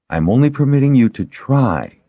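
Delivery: high-pitched line landing firmly on "try"; stress on try. A narrator's reading. Shara cautions her patient about the bandage, stressing "try". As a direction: lean on the final word "try". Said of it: "She stressed the last word".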